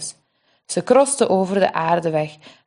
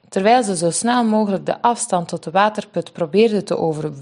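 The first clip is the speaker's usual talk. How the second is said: ordinary delivery, nothing marked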